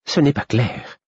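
uncertain, trailing off; hesitant. A narrator's reading. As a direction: falter over the words. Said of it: "hésitant".

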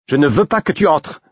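stubborn. A narrator's reading. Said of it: "fit-elle entêtée".

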